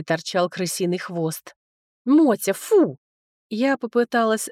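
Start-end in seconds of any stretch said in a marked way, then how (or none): 2.0-3.0 higher, louder telling-off of a pet; scolding command. A narrator's reading. Rider scolding her mount with a rat in its mouth, commanding. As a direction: order the animal sharply to drop it.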